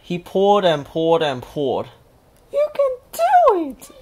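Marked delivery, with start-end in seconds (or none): 2.5-3.9 very high squeaky cheer; high excited cheer; cheering little Pai Hai. A narrator's reading. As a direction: urge the turtle on. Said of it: "yelled Nani".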